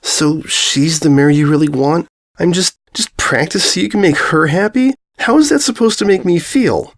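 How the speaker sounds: wounded questions, pitch climbing; hurt. Acted. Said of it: "Pain flashed in her eyes".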